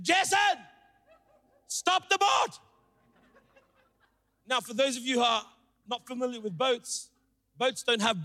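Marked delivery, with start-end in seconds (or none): 0.0-2.6 high-pitched mock yell; acted shout with long pauses between cries; imitated yelling dad. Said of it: "my dad starts yelling at me".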